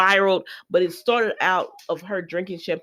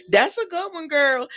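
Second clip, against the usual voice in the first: put-on that's a good one, girl pitched up with sass; sassy higher repeat; 8 semitones higher than usual. Vlogger echoing the compliment back, sassy and higher. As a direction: give it back with a higher teasing lilt. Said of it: "But, uh, you know".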